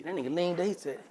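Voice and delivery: normal talking voice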